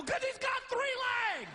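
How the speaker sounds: very high incredulous holler; comic outburst, pitch shooting way up; exasperated yell. Acted. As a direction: shout the painfully obvious. Yelling the obvious answer back.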